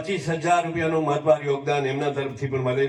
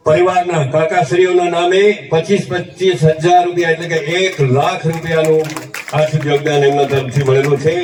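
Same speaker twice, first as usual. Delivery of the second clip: loud announcement; 10 dB louder than usual. Real, not acted. Announcing donation over loudspeaker.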